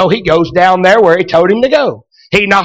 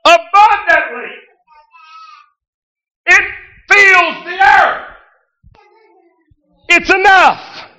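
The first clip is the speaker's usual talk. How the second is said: full-force preaching; 9 semitones higher, slower than usual. Acted. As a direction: preach it out, building to a shout. Shouted preaching climax.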